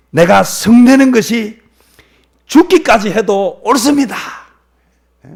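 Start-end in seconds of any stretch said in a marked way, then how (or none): 0.1-4.1 slow, high, loud self-justification; angry defiance. Acted. Jonah answers God back about his anger, defiant. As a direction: insist on it loudly, dragging the words. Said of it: "요나가 뭐라고 이야기하는가 하면요".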